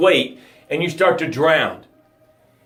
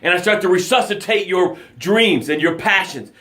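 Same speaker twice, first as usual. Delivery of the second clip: ordinary delivery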